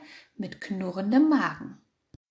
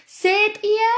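very high, loud triumphant shout; 12 semitones higher, 7 dB louder than usual. A narrator's reading. Hare held by the fox, shouting to the sheep. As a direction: shout it over the storm. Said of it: "schrie der Angsthase".

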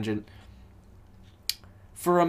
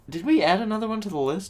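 sudden realization; 8 semitones higher, faster than usual. Real, not acted. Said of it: "Oh, uh, wait".

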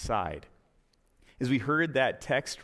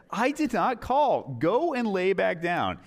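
disdain; 5 semitones higher, 4 dB louder, slower than usual. Acted. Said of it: "hear the disdain in this poor old man's voice".

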